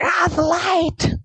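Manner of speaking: mock agony. Mock agony over flare blinding.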